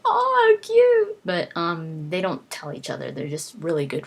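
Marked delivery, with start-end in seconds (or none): 0.1-1.2 squealing way up high and loud, gushing over a cute couple; high squeal of delight. Retold or imitated. Gushing about two old friends, squealed high and loud. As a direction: gush like a fan over a sweet couple. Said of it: "They're like".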